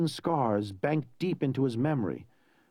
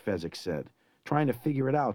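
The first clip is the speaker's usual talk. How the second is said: plain reading voice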